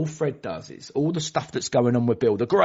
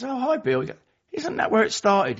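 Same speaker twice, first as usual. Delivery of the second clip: much higher, bright chatty voice; chirpy member; 10 semitones higher, 3 dB louder than usual. Retold or imitated. Meeting member chatting to Bill, chirpy and very high. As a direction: coo it sweetly at him.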